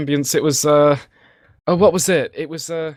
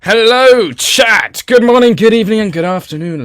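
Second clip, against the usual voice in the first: hammed-up stream greeting; 9 semitones higher, 9 dB louder than usual. Greets the live stream chat.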